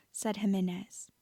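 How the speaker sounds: ordinary delivery, nothing marked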